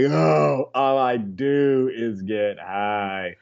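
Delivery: slow, low stoner drawl; drawn-out, laid-back low voice; mock stoner. Acting out a habitual stoner.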